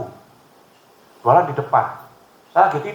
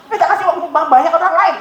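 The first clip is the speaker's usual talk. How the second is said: high, loud imagined scolding; mock angry telling off; 12 semitones higher, 6 dB louder than usual. Preacher imagines scolding a motorbike rider without lights, mock angry. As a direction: snap at the rider, half joking. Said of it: "Itu emosinya begitu".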